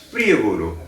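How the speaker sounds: ordinary talk, nothing marked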